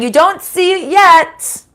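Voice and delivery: Spirit speaking. Retold or imitated. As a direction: deliver it like a voice from beyond, slowly. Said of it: "it's like Spirit saying".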